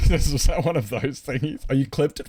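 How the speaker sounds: laughing loudly through the words; slowed, laughing loudly. Real, not acted. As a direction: talk while cracking up. Laughing at streamer's clip.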